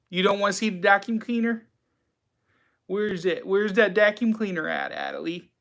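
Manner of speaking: lightly high pet talk. Acted. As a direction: coax the dog gently. Baby talk to the dog.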